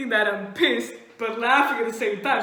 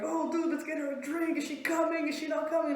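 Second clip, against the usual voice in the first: higher, softer excited chatter; eager friends; 5 semitones higher, 4 dB quieter, faster than usual. Retold or imitated. Friends asking if she is coming, eagerly. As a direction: imitate pals firing eager questions.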